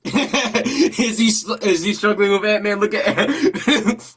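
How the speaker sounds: high, loud words through laughter; laughing hard. Real, not acted. Viewer mocking a struggling villain, laughing hard. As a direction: crack up while trying to ask. Cracks up at hero's beating.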